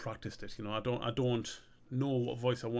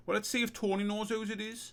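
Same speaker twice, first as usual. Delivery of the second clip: high-pitched character voice; acted suggestion; 9 semitones higher than usual. Character suggesting to his mate they see Tony, high-voiced. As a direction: pitch it high, offering an idea.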